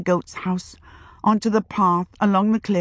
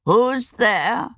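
put-on old witch calling out; 4 semitones higher than usual. A narrator's reading. Frightened old witch calls out.

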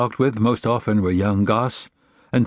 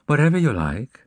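high, serene character voice; serene smile; 5 semitones higher than usual. A narrator's reading. Faxe answers the envoy's offer, serene high voice. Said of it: "said Faxe with a serene smile".

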